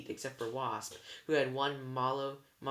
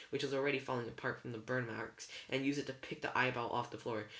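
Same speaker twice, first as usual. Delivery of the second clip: usual speaking voice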